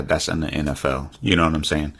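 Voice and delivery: usual speaking voice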